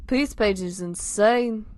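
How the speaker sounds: slow, emphatic Scouse; put-on Liverpool accent. Simon reporting on Pete's page, Liverpool accent. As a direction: stretch it out in amateur Liverpool accent. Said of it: "My accent's going everywhere".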